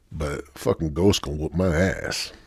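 usual speaking voice

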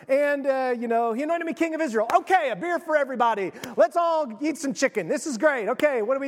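quick, breezy dodge; skirting the question. Retold or imitated. Jehu skirting his men's question, fast and breezy. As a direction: brush it off fast and light. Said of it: "skirting, like".

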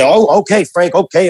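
put-on a kid going along happily; eager teen.

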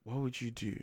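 hushed, lightly mock-profound imitation; philosophical, deep. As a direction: drop the volume as if posing a grave question. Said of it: "philosophical, deep".

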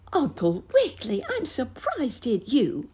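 high squeak of surprise; squeaky mouse teacher. A narrator's reading. Mouse teacher scolding the rabbit, squeaking. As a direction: squeak it like a shocked little mouse. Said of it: "squeaked the Lady Mouse teacher".

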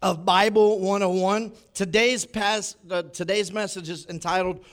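booming church welcome; preacher welcome. Acted. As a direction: open the service with energy. Opens sermon with preacher welcome.

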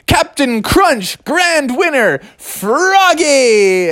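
high, slow proclamation; grand announcer. Acted. Reading the winner's banner aloud, grandly announcing. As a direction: stretch each phrase like a prize announcement. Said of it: "putting up signage that said".

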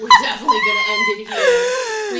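very high, loud, crying with laughter; laughing squeal. Real, not acted. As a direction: squeal it out mid laughing fit. Laughing fit ending the podcast.

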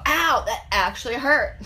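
mock-pained exclamation, slowed down; retold pain. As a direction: act out a slow, startled ouch. Acting out being hurt in match.

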